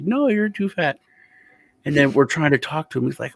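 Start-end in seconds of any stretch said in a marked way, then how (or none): 0.0-1.0 put-on high, curt old-man dismissal; imitating dismissive grandpa. Grandpa dismisses the grandson again, high curt voice. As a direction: brush the claim off bluntly.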